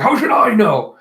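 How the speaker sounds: high, loud exasperated retort; acted-out irritated outburst; annoyed. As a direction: snap back in mock annoyance. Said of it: "Shichi's getting all annoyed".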